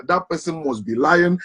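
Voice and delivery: usual speaking voice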